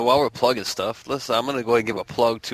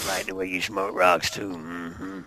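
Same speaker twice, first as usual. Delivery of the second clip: low, slow mumbling drawl; low, slow Sling Blade drawl; 3 semitones lower, slower than usual. Pretend. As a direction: drop into a slow low grumble. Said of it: "I can go into sling blade mode".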